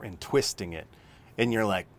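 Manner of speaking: ordinary delivery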